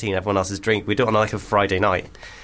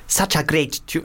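put-on South African accent; 5 semitones higher than usual. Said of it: "I'm going full".

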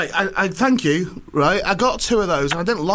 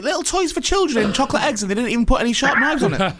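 high, mocking disbelief with a laugh; deadpan irony pushed way up high; sarcastic mock amazement; 9 semitones higher than usual. Mocking the toy makers to his co-host, sarcastic.

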